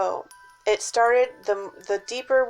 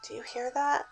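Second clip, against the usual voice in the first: soft, higher frightened whisper; hushed whisper; 4 semitones higher, 8 dB quieter than usual. Retold or imitated. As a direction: whisper it in fear. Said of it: "I'm like".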